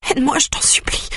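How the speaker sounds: high, whispered begging; whispered plea. Acted. Beaten sister begs her brother for help, whispering. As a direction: plead in a faint whisper. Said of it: "gémit ma sœur".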